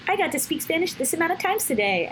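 high, gushing brag; proud excitement. Retold or imitated. Home telling her boyfriend, proudly excited. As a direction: burst in home, proud and thrilled.

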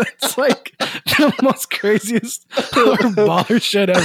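slow, drawn out through real laughter; laughing. Real, not acted. Telling friends the joke while laughing hard. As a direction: stretch it out while cracking up.

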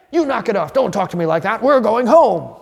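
angry scolding. Retold or imitated. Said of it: "Do you then say".

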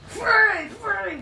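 loud excited cry of a name; excited shout. Real, not acted. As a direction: blurt it out thrilled.

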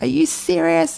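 high, indignant mock retort; reactive indignant. Her old reactive self snapping back at the ex, high indignant retort. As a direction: fire it back in disbelief, reacting. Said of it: "I used to just react and be like".

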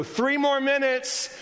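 high, slow, drawn-out warning shout; urgent warning call. Retold or imitated. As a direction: bellow it like a last call. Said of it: "stick their face in front of you and go".

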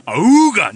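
loud high cartoon "auga" honk; eyes-popping cartoon wolf call; cartoon wolf awooga. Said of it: "that noise fucking comes out".

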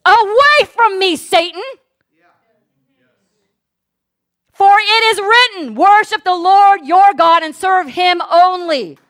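loud, slow, commanding rebuke; thundering order to get away; shouted rebuke. Acted. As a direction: cast him off with full force. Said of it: "he shouted".

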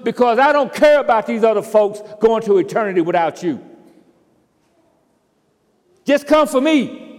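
loud, high, slowing on the last words; selfish prayer. Acted. Preacher voicing a self-centred believer, loud and high. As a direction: demand rescue only for yourself. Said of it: "what I would be saying is".

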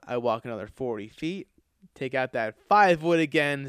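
usual speaking voice

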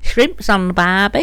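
broad Aussie twang; Australian accent.

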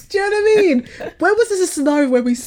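high, fast, loud indignant rant; outraged ghost rant. Pretend. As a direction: rant in disbelief, laughing. Ghost ranting at sister.

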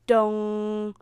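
long, loud monotone dong; clock chime. A narrator's reading. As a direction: ring out like a striking clock. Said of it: "The clock was quiet".

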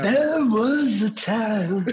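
slow, stretched out DJ line; DJ drawl. Pretend. Redoing his practiced DJ line, slow. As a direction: drag it slowly like a hype DJ. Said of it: "practiced that way too much".

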